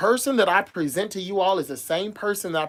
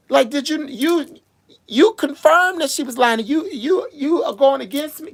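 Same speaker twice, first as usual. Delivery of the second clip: high-pitched, louder, incredulous outrage; rising, louder fury at being betrayed; real disbelief rising into outrage; outraged disbelief; 9 semitones higher, 6 dB louder than usual. Real, not acted. Outraged confrontation over betrayal.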